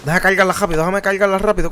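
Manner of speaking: ordinary talk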